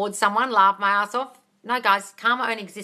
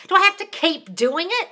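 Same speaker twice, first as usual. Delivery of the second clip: higher, louder mock whine; 9 semitones higher, 6 dB louder than usual. Mocking viewers who see it as a chore. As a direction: mimic a whining viewer. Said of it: "if you're seeing this as some kind of chore".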